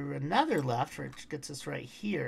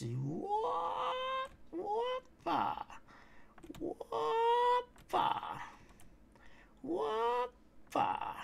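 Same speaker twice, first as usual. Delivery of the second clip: much higher, silly sing-song whoopa; playful whoopa; 3 dB quieter, much slower than usual. Real, not acted. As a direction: toss out a goofy, high-pitched whoopa. Playful exclamations while running.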